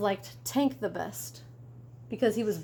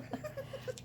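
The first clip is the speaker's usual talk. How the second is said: high squeal of laughter; 15 dB quieter than usual. Real, not acted. Laugh at the butt joke.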